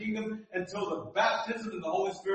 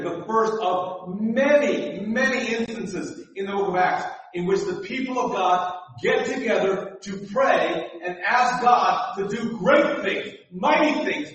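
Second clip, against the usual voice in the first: louder and higher on each phrase; building sermon climax; 3 semitones higher, 4 dB louder than usual. Real, not acted. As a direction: climb with great, mighty, wonderful.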